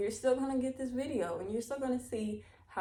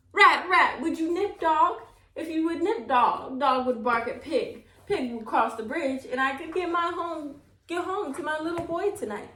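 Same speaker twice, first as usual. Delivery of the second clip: pleading; 7 semitones higher, 4 dB louder than usual. A narrator's reading. Old woman pleading with the rat.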